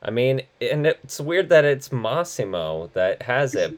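ordinary talk

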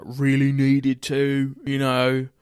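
hammed-up British accent, drawn out. Shown as a demonstration. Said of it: "or I could do, like, British".

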